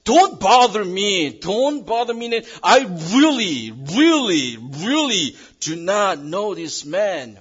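loud, high, slow insistent denial; desperate denial. Acted. Peter to his accusers, a loud pleading denial. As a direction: shove them off, pleading and angry. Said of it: "clearly expressed".

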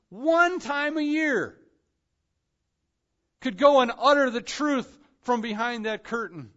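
high, swelling preacher's proclamation; impassioned preaching. Real, not acted. Preacher marveling at the torn curtain, impassioned proclamation.

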